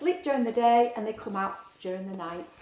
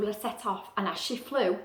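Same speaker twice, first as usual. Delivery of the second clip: plain reading voice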